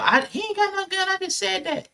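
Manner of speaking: high, put-on voice of the lying mother; high mocking imitation of the caller. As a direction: squeak it out like the caller backpedalling. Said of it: "She came out".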